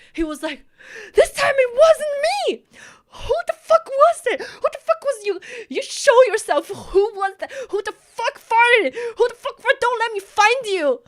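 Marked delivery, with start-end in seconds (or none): 1.1-11.0 high, loud enraged shouting; furious yelling. Retold or imitated. Classmate accused of the fart yells back in fury. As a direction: scream the denial, furious at the accusation. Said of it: "he was so pissed".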